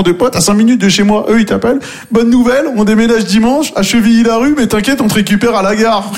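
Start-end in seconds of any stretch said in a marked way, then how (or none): 2.1-6.1 higher, bright mock friend on the phone; cheery friend voice. Scene friend phoning about moving day, high cheery mock voice. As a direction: play the breezy friend asking a favour.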